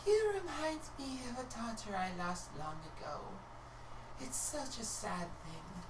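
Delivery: a mother mourning her lost daughter; grieving mother. Acted. Dragonborn mother telling Fia about her lost daughter, sadly. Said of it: "she looks at Fia and she goes".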